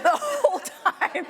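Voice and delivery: high, slow laughing words. Real, not acted. Remembering the cliff, laughing it out. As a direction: laugh through it. Laughing at her own yelling.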